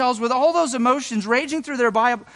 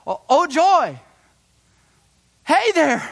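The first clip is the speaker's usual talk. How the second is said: higher, louder happy hello; joyful greeting; 4 semitones higher, 3 dB louder, much slower than usual. Retold or imitated. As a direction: greet them like a delighted friend. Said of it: "this might have been a".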